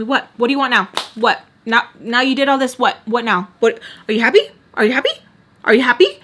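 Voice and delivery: loud, rapid-fire badgering questions; punchy, repeated challenge; quarrelling mother. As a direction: imitate a mother snapping in a fight. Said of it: "me and her are still fighting".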